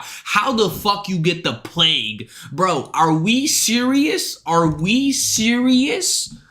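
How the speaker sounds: high, slow, drawn-out disbelief; real disbelief at the news; incredulous disbelief. Real, not acted. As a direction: stretch it out in stunned disbelief.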